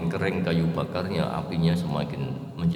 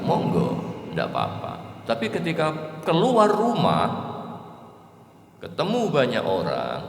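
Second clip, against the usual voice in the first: preaching; 3 semitones higher, 3 dB louder than usual. Real, not acted. Preaching builds on social conduct.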